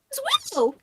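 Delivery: squeaky, high dwarf voice; tiny voice from a burly dwarf; very high-pitched voice. Acted. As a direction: pipe up absurdly high. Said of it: "says in a very high-pitched voice".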